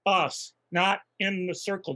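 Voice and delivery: slow, deliberate. Acted. Explains slowly to guards.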